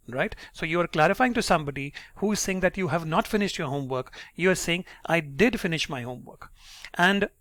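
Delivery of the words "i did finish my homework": "did" lifted a little in pitch; stress on DID. Shown as a demonstration. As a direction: firmly correct them with weight on "did". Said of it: "your pitch goes a little high on did".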